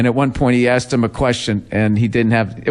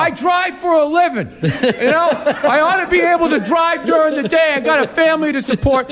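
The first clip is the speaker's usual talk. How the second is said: mock complaining driver. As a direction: shout the excuse like an aggrieved worker. Mocking another complaining classmate.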